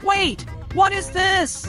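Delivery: sudden high startled "wait"; surprised. Real, not acted. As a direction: caught off guard, voice jumps up. Car suddenly drives by itself.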